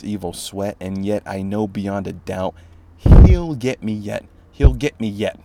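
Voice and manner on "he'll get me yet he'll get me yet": dramatic loud line. Acted. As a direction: hammer home the dread. Poem climax.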